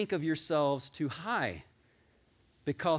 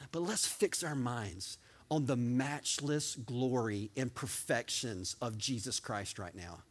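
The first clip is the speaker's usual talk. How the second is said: slow, soft and hushed, much lower; reverent hush; 5 semitones lower, 6 dB quieter, slower than usual. Real, not acted. Preacher slowing the congregation down, soft and reverent. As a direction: draw the church into quiet wonder.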